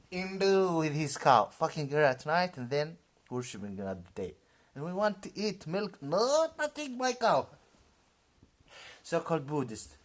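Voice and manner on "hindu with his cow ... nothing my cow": mock jeering. Mocking Hindus in rant.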